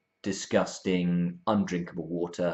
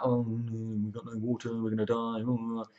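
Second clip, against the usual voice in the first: higher, softer mock whine; grumbling moan; 4 semitones higher, 5 dB quieter than usual. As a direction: moan it like hard-done-by grumblers. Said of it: "they're grumbling".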